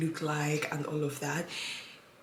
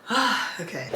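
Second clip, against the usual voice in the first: usual speaking voice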